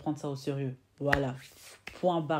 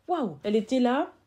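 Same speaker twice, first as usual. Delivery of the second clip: 1 mock astonishment; 8 semitones higher, 7 dB louder than usual. Reacting to the girl's shock.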